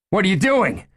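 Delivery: high, loud, panicked shout. Acted. Gunman shouting as the chief walks at him, panicked yell. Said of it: "Ed shouted".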